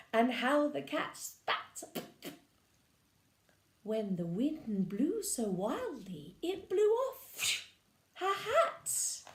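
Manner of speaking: lively storybook narration; reading to children. Acted. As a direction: read it like bedtime to little ones. Reading picture book aloud.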